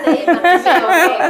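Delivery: drawn-out sí, Bartimeo shaken by loud laughter; real laugh breaking through the words. Real, not acted. (respuesta entre carcajadas)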